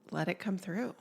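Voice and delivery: ordinary delivery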